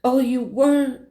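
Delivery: high, slow imitation of tearful mom; mom starting to cry. Mom learns of the surprise visit, starting to cry. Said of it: "She starts crying".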